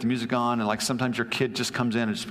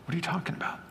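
high, hushed mock innocence; embarrassed deflection; 6 semitones higher, 5 dB quieter, much faster than usual. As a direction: play caught out, small high voice. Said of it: "Simon looks around embarrassed".

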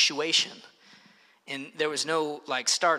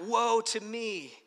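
mourner's cry; 5 semitones higher than usual. Acted. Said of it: "they would cry out".

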